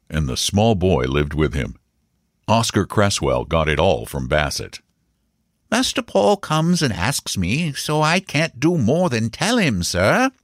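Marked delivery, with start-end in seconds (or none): 5.7-10.3 high-pitched, earnest servant explaining; solemn and deferential, pitched well up; grave as if speaking of religion; terribly serious. A narrator's reading. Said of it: "his face terribly serious".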